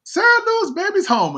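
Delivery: very high squealed welcome; mock falsetto cry of joyful greeting; imitating overjoyed relatives. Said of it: "literally clapping and jumping up and down".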